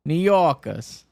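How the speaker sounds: badly faked New York accent; failed New York accent. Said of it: "tried to imitate a New York accent and failed".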